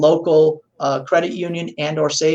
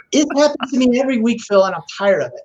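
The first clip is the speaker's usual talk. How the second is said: imitating annoyed owner; 6 semitones higher, faster than usual. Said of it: "was yelling at his mail".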